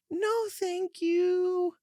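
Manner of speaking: squeaky, slow polite refusal; very high, drawn-out no thank you; high-pitched. A narrator's reading. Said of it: "increasingly smile and high-pitched".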